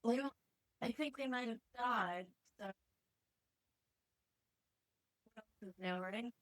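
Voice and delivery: echoey room sound. Real, not acted. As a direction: voice bouncing off bare walls. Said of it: "You sound kinda echoey".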